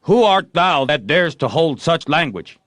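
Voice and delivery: high, loud mock-heroic challenge; haughty knight. A narrator's reading. Joe as Guy of Gisborne after the prompt, loud and grand. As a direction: fling the line back grandly. Joe repeats his line.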